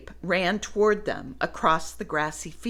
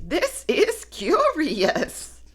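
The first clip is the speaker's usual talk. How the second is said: slow, higher, drawn-out musing; slow wonder; 9 semitones higher, slower than usual. Acted. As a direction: savour each word, puzzled. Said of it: "said Woolly".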